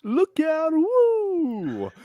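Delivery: high, whooping cheer; excited yell. Pretend. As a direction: whoop like a bystander. Calling out in the scene.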